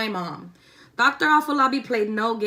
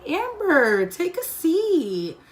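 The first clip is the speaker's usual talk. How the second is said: a hint of a sweet-talking principal, higher and slow; fake nice principal; 7 semitones higher, 3 dB louder, much slower than usual. Principal calls the student in, lightly mocked sweet tone. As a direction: lightly play the principal being suspiciously nice.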